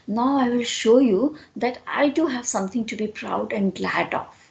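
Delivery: usual speaking voice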